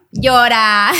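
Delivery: a loud sudden order to cry; mock command. Therapist ordering her to cry, loud and sudden. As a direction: fire off the word like a command. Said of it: "me dijeron".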